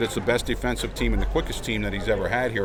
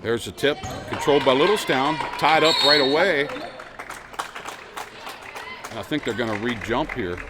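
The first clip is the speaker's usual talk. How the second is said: fast sports commentary; 5 semitones higher, 3 dB louder than usual. Acted. Live play-by-play of a game.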